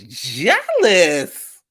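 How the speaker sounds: a hint of [name] drawn out; mild [name] impression. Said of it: "In my [name] voice".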